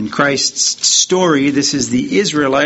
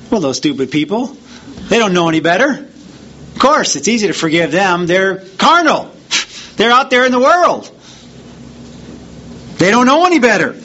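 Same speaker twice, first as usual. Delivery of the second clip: mock dismissive; 5 semitones higher than usual. Preacher mimicking believers who look down on pagans, scornful. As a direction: sneer at the outsiders as if they were beneath you. Mocking dismissive view of outsiders.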